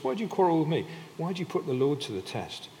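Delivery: high, loud protesting questions; Moses, exasperated. Acted. Moses challenges the people who quarrel, exasperated protest.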